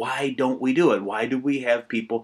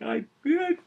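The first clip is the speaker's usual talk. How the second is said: higher, half-hammed tearful voice; mock tearful; 10 semitones higher than usual. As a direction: play at choking up over the kids. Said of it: "I'm a tearing up".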